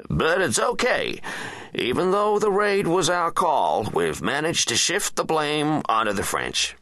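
high, slow, placating official; defence secretary explaining nervously. Acted. Secretary telling the president about shifted blame, high and placating. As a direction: reassure the boss in a high, slowed voice.

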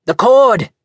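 high, loud shout of alarm. A narrator's reading. Doctor shouting at the midwife about the cord, urgent shout. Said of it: "he shouted".

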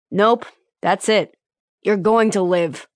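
slow, deadpan reassurance; dryly. Acted. Lieutenant telling the wounded man he'll live, dryly. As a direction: say it flat and unimpressed. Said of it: "Lieutenant Lopez said dryly".

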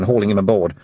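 ordinary delivery, nothing marked

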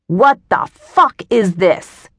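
slow, heavy yell with a changed voice. A narrator's reading. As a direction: drag out the outburst, loud and slow. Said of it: "he yelled".

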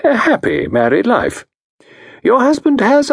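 diagnose her case with calm authority; a genteel consultant voice; Parker Pyne. Acted.

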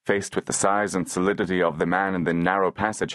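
plain reading voice

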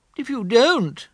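high, earnest little bear voice; a thoughtful teddy bear making a decision; Pooh voice. Acted. Pooh voice in the story.

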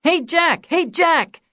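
put-on high, loud child's call across the yard; eager boy hailing his hero from afar; kid calling out. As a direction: shout for Jack like an eager little kid.

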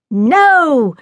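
loud, high, drawn-out refusal; crying out. A narrator's reading. Jack stops his cousin reading to him, crying out. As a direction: cry out to block her reading. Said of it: "cried Jack".

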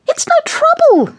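very high, fussy lady's voice; breezy, high-pitched insistence; insistent neighbour. A narrator's reading. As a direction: brush off the objection, pitched right up. Neighbour insists on giving lift.

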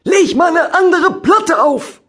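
loud, high, slow angry shout; bellowing. Acted. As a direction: roar it at her, slowly. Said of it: "brüllte er".

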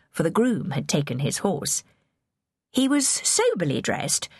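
plain reading voice

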